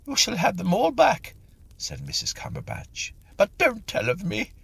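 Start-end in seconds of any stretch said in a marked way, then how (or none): 0.1-1.3 high, louder frightened plea; pleading medium. Acted. The caught medium begging Mister Tilly, high frightened plea. 3.4-4.5 high, louder frightened plea; pleading medium. Acted. The caught medium begging Mister Tilly, high frightened plea.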